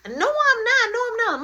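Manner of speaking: high, loud protest, said twice; the accused girl protesting to mom, high and loud; loud denial. Retold or imitated.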